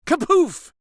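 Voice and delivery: loud, high kapoof sound; loud high playful kapoof; snowball thud. A narrator's reading. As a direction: burst it out like a snowball hitting.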